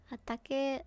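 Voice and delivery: whispered ominous title; dramatic whisper. Pretend. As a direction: whisper it like a spooky trailer.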